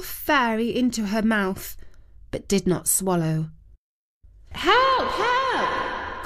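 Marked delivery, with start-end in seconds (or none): 4.5-6.2 high, desperate cry for help; calling for help. A narrator's reading. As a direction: call out high and panicked. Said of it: "called out Queen Mab".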